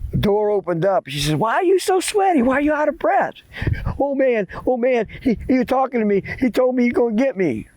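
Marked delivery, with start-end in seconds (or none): 1.4-3.3 very high-pitched grandma voice; startled grandma. Acted. Grandma questions sweaty boy at door. 4.0-7.7 high-pitched, stumbling panic; bothered, breathless boy. Acted. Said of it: "he was all bothered".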